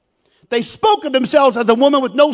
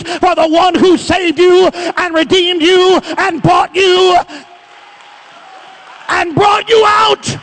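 loud, high, ringing exhortation; full-throated revival preaching; preaching at full force; 6 semitones higher, 3 dB louder than usual. Acted. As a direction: whip the congregation up into praise. Preacher exhorts congregation, shouting.

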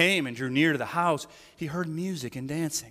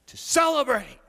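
"celebrate" rung out loud and high, falling at the end; joyful proclamation; 9 semitones higher, 5 dB louder than usual. Real, not acted. Preacher proclaiming the feast to the congregation, loud and high.

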